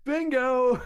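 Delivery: high, loud winner's shout; imitated yell. Father retelling his daughter's bingo win, high mock yell. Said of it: "So she yells".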